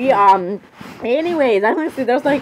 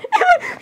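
high squealing giggle. Acted. Swooning giggle at Jeff.

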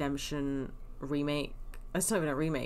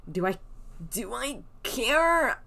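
mock drawl; 6 semitones higher, slower than usual. Rhetorical mock question.

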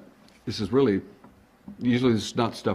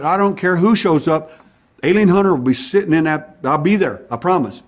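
loud determined vow; 7 semitones higher, 8 dB louder than usual. Retold or imitated. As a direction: vow it loudly. Promising wife he'll be there.

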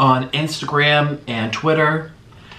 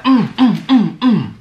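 savoring mms; 5 semitones higher, 5 dB louder, slower than usual. Real, not acted. Food rave, savoring sounds.

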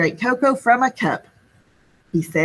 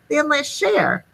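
put-on high little girl voice; a hint of a small girl offering; lightly voiced little girl; 7 semitones higher than usual. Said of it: "A little girl said".

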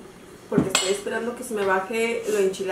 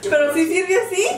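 laughing; 8 semitones higher, 4 dB louder than usual. Real, not acted. Laughing at the flipped stove.

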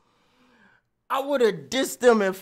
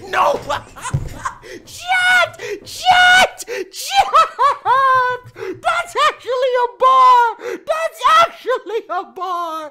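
ecstatic yelling; 13 semitones higher, slower than usual. Real, not acted. Streamer shrieking at chat that his bar is real, overjoyed. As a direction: scream with laughing disbelief.

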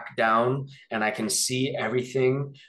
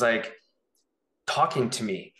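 usual speaking voice

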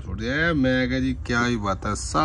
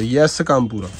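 ordinary talk, nothing marked

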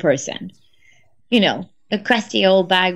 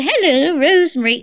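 put-on higher, fluttery old mother answering the phone; elderly mum voice; 6 semitones higher, 4 dB louder than usual. [name] imitating her mum answering the phone, higher and confused.

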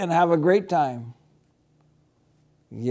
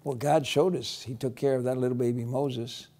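ordinary delivery

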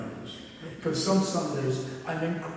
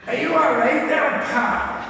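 loud, much higher Scottish "are you all right there, pal"; mock Scottish challenge; 9 semitones higher, 8 dB louder than usual. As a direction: play a Scots tough sizing you up, loudly. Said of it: "when Scots called you pal, it wasn't affectionate".

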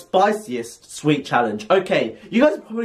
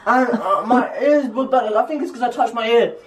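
laughing through pain; 9 semitones higher, slower than usual. Real, not acted.

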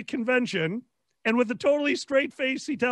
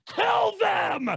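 battle shout; 9 semitones higher than usual. Retold or imitated. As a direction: bark the order to attack.

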